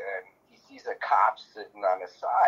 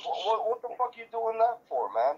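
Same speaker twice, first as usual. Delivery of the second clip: angry cop; 10 semitones higher than usual. Retold or imitated. Cop confronting Ziggy.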